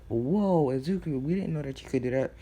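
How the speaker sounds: high, loud astonished "whoa", easing down; amazed crowd reaction. Retold or imitated. Classmates astonished by Izuku's party bus, amazed. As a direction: react in awe, voice jumping up.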